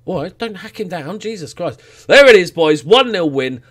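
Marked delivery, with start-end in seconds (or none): 2.1-3.6 1 triumphant. Real, not acted. Final whistle win.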